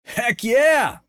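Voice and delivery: high eager shout; Gary cheering from the back seat. A narrator's reading. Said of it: "said Gary".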